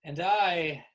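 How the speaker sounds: loud, halting, drawn-out reveal; Kimberly making a confession. Acted. Kimberly admits being the true Winky Wally, halting grand delivery. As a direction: announce it grandly, a few words at a time.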